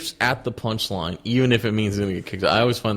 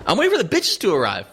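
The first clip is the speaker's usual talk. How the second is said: much higher mimicking voice; high-pitched imitation of his answer; James; 10 semitones higher than usual. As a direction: quote him in an earnest, raised pitch.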